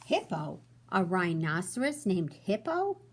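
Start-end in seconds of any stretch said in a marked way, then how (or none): 0.1-0.6 storybook read-aloud, expressive and even; reading to children. A narrator's reading. Teacher reading to her class, storybook voice. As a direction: read it like bedtime story time.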